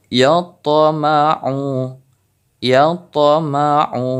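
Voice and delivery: very slow "yatama'u" with the t rebounding; qalqalah on ta. Shown as a demonstration. Teacher demonstrating qalqalah, slow bounced ta. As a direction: echo the ta in "yatama'u". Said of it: "Contoh selanjutnya".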